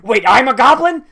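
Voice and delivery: loud, high "wait, what?"; a loud, high shocked question at the screen; startled outburst. Real, not acted. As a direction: blurt it out in sudden disbelief. Shocked reaction to the show.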